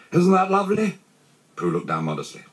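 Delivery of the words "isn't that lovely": high, loud exclamation; delighted. A narrator's reading. Christopher Robin rejoices over Pooh.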